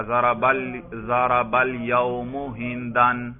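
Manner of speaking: slow, deliberate reading of the Arabic; slow quoted sentence. Retold or imitated. Teacher slowly voicing a student's example sentence. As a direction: read the example word by word, slowly. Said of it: "ایک طالب علم اشکال کرتا ہے".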